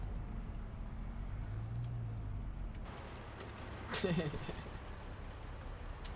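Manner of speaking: long silent wheezy laugh; wheezing laugh. Real, not acted. Long laugh after teasing.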